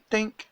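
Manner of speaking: high-pitched little "dink" noise; a tiny, high sound effect for a line breaking; quick high ping; imitated snap. Imitates the fishing line snapping.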